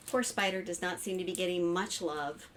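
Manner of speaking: usual speaking voice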